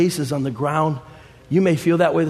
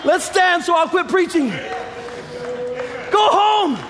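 preaching at full force. Acted. Preacher exhorts the congregation.

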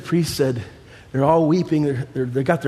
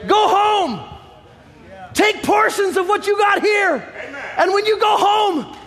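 preaching at full force. Acted. Preacher exhorts the congregation.

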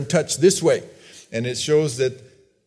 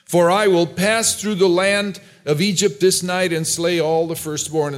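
higher, ringing decree read from scripture; scripture proclamation; 4 semitones higher than usual. Acted. Preacher reading the Lord's warning to Pharaoh, forceful scripture proclamation. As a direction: proclaim it as God's solemn command.

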